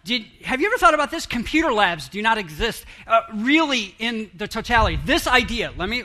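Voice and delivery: ordinary talk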